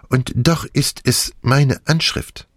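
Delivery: ordinary delivery, nothing marked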